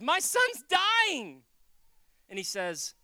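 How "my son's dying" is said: loud, high, drawn-out cry; acted anguish, stretched and raised; desperate parent's plea. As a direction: wail it out slowly as a plea. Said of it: "Relationship goes".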